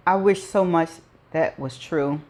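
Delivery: ordinary delivery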